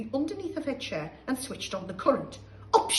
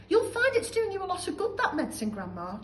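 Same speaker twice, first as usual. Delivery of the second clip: put-on boy George; 9 semitones higher, 3 dB louder than usual. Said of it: "George said".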